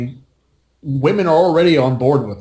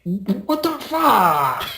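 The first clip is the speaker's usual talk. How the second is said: slow, drawn-out disbelief; slow mock bewildered reply; incredulous; 7 semitones higher, much slower than usual. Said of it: "And I'm like".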